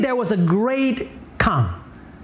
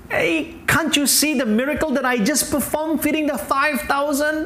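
high-pitched, incredulous appeal; exasperated Jesus; 6 semitones higher than usual. Acted. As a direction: plead with followers who still don't get it. Acts Jesus pleading with disciples.

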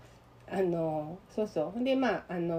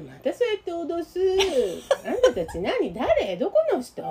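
higher, louder mock accusation; imitating the suspicious secretary; 8 semitones higher, 5 dB louder than usual. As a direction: demand who they are, suspicious. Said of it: "って言われて". (不審がる秘書を真似て問い詰める)

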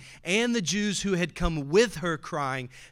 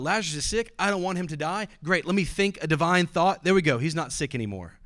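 louder, higher, mock-casual fix; breezy mock Jesus; 4 semitones higher, 7 dB louder than usual. Preacher mocking a casual Jesus, louder and higher. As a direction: lightly voice a breezy Jesus solving it. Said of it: "So Jesus could have gone".

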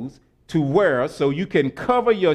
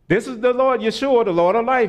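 usual speaking voice

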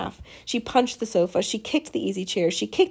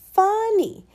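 sarcastic; 12 semitones higher, 4 dB louder than usual. Acted. Amanda sarcastic retort.

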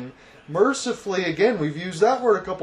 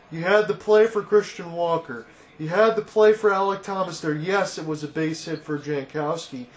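ordinary delivery

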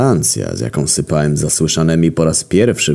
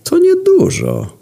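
calm; 3 dB louder, slower than usual. A narrator's reading. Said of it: "odrzekłem spokojnie".

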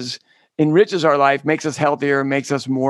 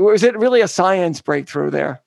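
ordinary talk

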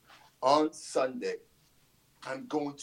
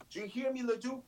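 angry taunt; 9 semitones higher, 4 dB louder, faster than usual. Acted. Taunting rival during promo.

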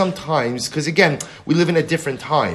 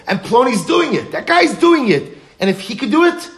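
rising high and louder with excitement; excited realization; 9 semitones higher, 4 dB louder than usual. Acted. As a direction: build to an amazed, high-pitched peak. Onlookers realizing it is possible.

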